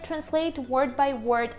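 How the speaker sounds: normal talking voice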